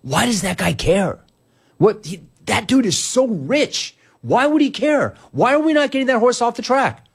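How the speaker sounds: high, pleading incredulous questions; baffled that a rich guy cares; exasperated disbelief. Retold or imitated. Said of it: "And I'm like".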